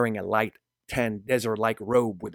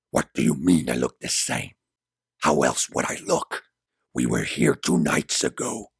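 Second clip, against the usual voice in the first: deep, baffled man's protest; low and bewildered; low confused voice; 10 semitones lower, 3 dB louder than usual. Acted. Gabriel confused.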